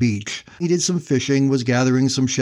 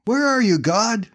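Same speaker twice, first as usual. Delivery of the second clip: high, anguished cry to heaven; desperate cry; 10 semitones higher than usual. Acted. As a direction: call out to an absent God, pitched high. Stranded man questioning God.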